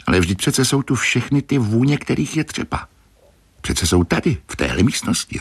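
lightly voiced, insistent young helper, starting high; a touch of excited pleading; eager apprentice. A narrator's reading. Grenouille points out the scents in the room, mildly eager.

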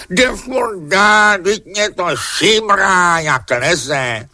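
high, slow, drawn-out wailing whine; uhňaně. A narrator's reading. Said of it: "uhňala". (vysoké táhlé kňourání)

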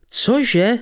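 high startled cry of horror; horrified high cry of disbelief; aghast. Acted. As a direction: yelp it in shock. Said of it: "zvolal s úděsem".

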